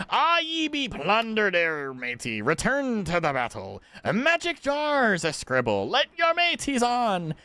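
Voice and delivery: put-on big theatrical pirate accent; pirate speak. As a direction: read it out like a hearty sea dog. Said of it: "Pirate speak?".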